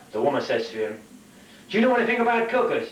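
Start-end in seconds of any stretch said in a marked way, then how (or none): 1.7-2.9 high, loud mock holler; comic high-pitched yell; calling out. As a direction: call out urgently to someone passing in mid-air. Calling across while flying past.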